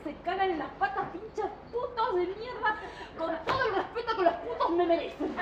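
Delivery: loud comic cursing, slowing on the insults; mock furious rant. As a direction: spit the curses out for laughs.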